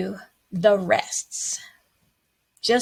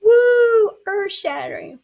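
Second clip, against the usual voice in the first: mock amazement; 13 semitones higher, 5 dB louder than usual. Mock amazement at whole rest.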